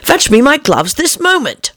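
high, fussy rabbit command; impatient order. A narrator's reading. The Rabbit ordering his gloves, high and fussy. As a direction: snap an order at the servant. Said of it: "said the voice".

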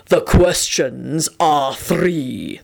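very slow, drawn-out announcement; pleased sphinx. A narrator's reading. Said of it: "announced in a pleased voice".

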